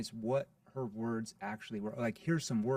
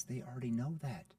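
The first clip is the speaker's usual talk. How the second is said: ordinary talk, nothing marked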